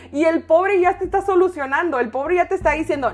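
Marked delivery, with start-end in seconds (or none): none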